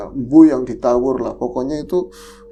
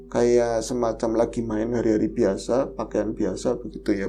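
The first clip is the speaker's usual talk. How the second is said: normal talking voice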